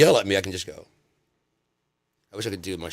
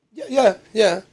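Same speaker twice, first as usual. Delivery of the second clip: gullible agreeing; 13 semitones higher, 5 dB louder than usual. Retold or imitated. Gullible believer.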